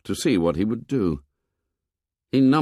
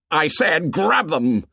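a touch of an angry shout, higher; 6 semitones higher than usual. A narrator's reading. Jack orders his tribe again, mildly angry shout. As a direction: repeat the order with mild force. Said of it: "Jack shouted angrily".

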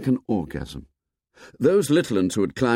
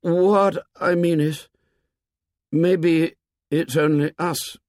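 put-on slow, higher-pitched halting boy; tentative, drawn-out explanation with pauses; struggling for words; 7 semitones higher, slower than usual. A narrator's reading. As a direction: grope slowly towards the idea. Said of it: "Simon became inarticulate in his effort".

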